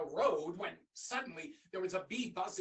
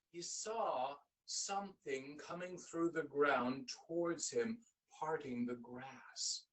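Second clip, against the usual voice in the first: ordinary delivery, nothing marked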